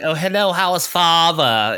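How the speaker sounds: put-on high, loud, overdone British accent; mock British accent. Imitating the actress greeting family in the film, hammed-up British accent. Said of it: "she's supposed to be British".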